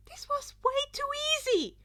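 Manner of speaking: high, loud, giddy crow of glee; gleeful inner thought. A narrator's reading. Izuku delighting in his own trick, giddy and high. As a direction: squeal it like it's almost too easy.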